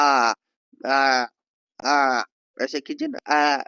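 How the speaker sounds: loud open आ with the jaw dropped; open aa demo. Shown as a demonstration. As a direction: drop the jaw and call out आ. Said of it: "आ बोलते हैं तो जबड़ा थोड़ा नीचे आ जाता है". (जबड़ा नीचे करके खुला आ)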